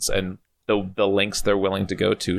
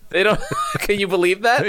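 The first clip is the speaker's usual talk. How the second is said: mock outrage; 10 semitones higher than usual.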